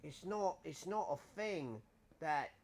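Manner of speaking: hammed-up British accent, mate and innit. Gamer joking about bloody monsters in a hospital, mock British accent. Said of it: "It's a wicked accent, mate, innit?".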